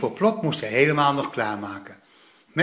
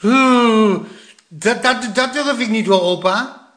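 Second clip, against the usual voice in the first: put-on squeamish boy; 7 semitones higher, 5 dB louder than usual. A narrator's reading. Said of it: "zei Plop".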